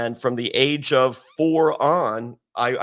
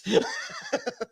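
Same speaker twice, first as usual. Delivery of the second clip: shrill high-pitched mock laugh; high cackle; 5 dB quieter than usual. Finishing the Terry Funk bit with a high cackle. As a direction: cap the impression with a squeaky laugh.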